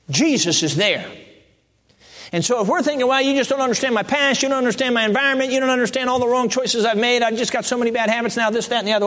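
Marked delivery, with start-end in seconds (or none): 3.1-9.1 higher, rattled-off complaint; protesting excuses. Acted. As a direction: reel off excuses in a raised pitch. Acts out believer making excuses.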